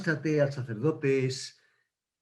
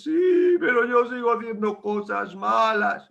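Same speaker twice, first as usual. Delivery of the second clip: whiny protest; 6 semitones higher than usual. Acted.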